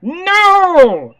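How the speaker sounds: high, loud cry of defeat; frustrated shout. Real, not acted. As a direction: yell in frustration. Loses the round.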